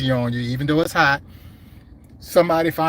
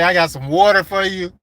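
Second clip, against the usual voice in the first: hammed-up and louder, higher coworker offer; imitating Gibbs; 5 semitones higher, 7 dB louder than usual. Quoting Gibbs again with a bigger, louder mock voice. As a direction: play up the buddy's water offer, louder. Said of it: "Gibbs say".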